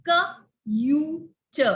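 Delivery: "K, U, T" as separate sounds with pauses; long "you" for the U between k and t; segmented phonics demo of "K, U, T"; sounded out letter by letter. Shown as a demonstration. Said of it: "if I put a magic E".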